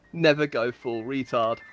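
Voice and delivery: ordinary delivery, nothing marked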